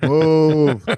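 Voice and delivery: long cow call; imitating cow. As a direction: moo it out like a cow. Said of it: "This is what the cow was saying".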